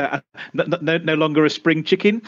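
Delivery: normal talking voice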